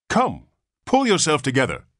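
high, loud brisk order; commanding. A narrator's reading. Nurse ordering the father, commanding. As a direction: snap him out of it. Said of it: "commanded the nurse".

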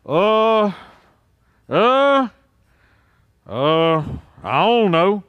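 mock hesitation, drawn-out uhs with gaps; dithering crowd. Preacher mimics Israel unable to pick a side, mock dithering. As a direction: imitate people stalling over a decision. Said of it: "people just stood there and said".

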